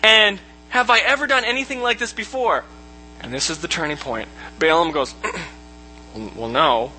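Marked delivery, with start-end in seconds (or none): 0.0-2.6 high, insistent donkey protest; higher, mildly indignant acting; lightly acted talking donkey. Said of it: "Donkey just keeps talking". 5.2-6.9 low, hesitant admission; lower, mumbly backing down; sheepish Balaam. Acted.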